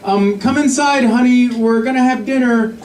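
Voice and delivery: high, slow, tentative mom voice; drawn-out, high-pitched reluctant request; hesitant. Retold or imitated. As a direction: imitate a timid mother coaxing her son indoors. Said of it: "you can hear her hesitating".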